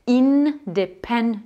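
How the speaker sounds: "independence" slowly, syllable by syllable, each N held; sounded English N. Shown as a demonstration. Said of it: "en anglais, logiquement, ça va donner". (« independence » lentement, tous les N prononcés)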